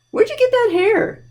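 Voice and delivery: high, playful mock amazement; mock surprise. Librarian teasing the felt monster about its hair, mock surprise. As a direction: pretend to be shocked at the puppet's hair.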